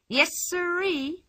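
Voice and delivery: high, slow, pleased drawl; delighted. A narrator's reading. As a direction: savour it, delighted. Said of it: "she announced, delighted".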